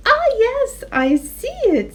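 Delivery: high bleaty ah yes; delighted sheep. Acted. Daisy the sheep sees it, high delight. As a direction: marvel at the sky.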